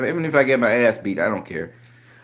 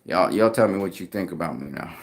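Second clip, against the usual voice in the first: usual speaking voice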